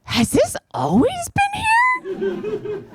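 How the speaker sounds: put-on very high, tender baby talk; cooing pet talk. Talking to her own body like a pet, high cooing. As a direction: coo to her like a pet cat. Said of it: "I was like".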